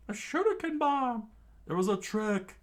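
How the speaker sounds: very high, loud surprised cry; high crew shriek of surprise; screamed in surprise. Acted. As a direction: shriek it out as the trick dawns. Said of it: "Don Creek's crew screamed out in surprise".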